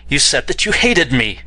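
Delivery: high, incredulous protest; astonished cry. A narrator's reading. David reminds Diane she said she hated him, astonished cry. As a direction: throw it back at them in disbelief, pitch jumping up. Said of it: "I cried".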